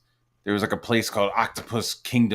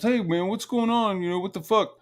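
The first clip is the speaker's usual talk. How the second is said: high-pitched imitation of baffled protest; mock voice, pitched well up; Yellow Flash; 10 semitones higher than usual. Friend asking the group what's happening, baffled high voice. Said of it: "Yellow Flash was".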